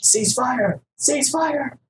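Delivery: high-pitched yell held back in volume; mock battlefield yell, pitch jumping way up; pretend yelling. Player acting out a plea to stop shooting, high mock yell.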